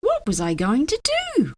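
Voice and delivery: ordinary delivery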